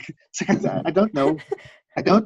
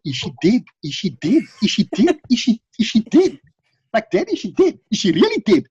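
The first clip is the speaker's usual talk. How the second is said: imitating kids asking again and again, pitched higher; kids pestering; 6 semitones higher than usual. Kids badgering their dad whether the TV character died, mock-anxious and high. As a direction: play the kids nagging their dad about the dead character, rising higher each time. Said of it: "kept asking over and over".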